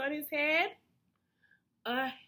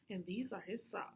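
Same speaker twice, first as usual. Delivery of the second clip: whisper; 6 semitones lower, 13 dB quieter, much faster than usual. Real, not acted. Aside to listener, whispered.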